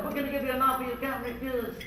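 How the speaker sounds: soft, hint of a Godfather mumble; Marlon Brando. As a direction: make the offer like a quiet mob boss. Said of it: "From Marlon Brando".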